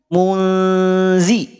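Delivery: "Mulzi" with lips drawn back, thin zal; correct pronunciation. Shown as a demonstration. Teacher showing the thin zal in "Mulzi". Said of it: "Harusnya ditarik".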